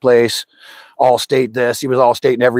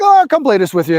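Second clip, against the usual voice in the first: breezy offer; 11 semitones higher, faster than usual. Retold or imitated. Retold casual offer.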